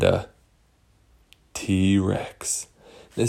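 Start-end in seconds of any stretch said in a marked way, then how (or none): none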